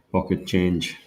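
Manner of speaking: usual speaking voice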